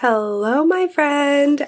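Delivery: ordinary talk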